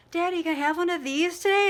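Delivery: put-on high, pleading little girl voice; little girl asking. A little girl begs her dad for an energy drink, high and pleading. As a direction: play the small daughter asking her dad sweetly. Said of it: "she said to her dad".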